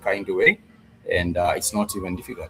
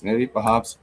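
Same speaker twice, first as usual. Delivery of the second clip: ordinary talk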